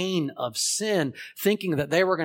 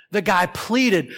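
rising urgency; 4 semitones higher, 8 dB louder, slower than usual. Acted. Builds into the debtor's plea.

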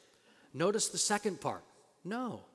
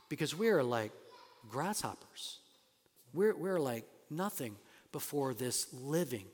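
ordinary talk, nothing marked

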